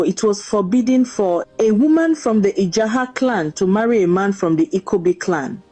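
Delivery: ordinary delivery, nothing marked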